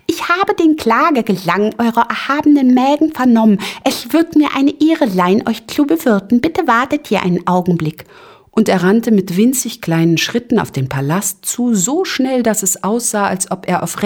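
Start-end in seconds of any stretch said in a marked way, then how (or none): 0.0-8.0 high, birdlike chirping little voice; tiny courteous host, chirping; twittering Ping-Pong. A narrator's reading. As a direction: twitter it politely, high and light. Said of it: "und zwitscherte".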